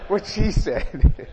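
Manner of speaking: a mock-triumphant, slow, laughing yes; gloating laugh. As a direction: laugh loudly, then stretch the words out slowly. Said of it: "I was like".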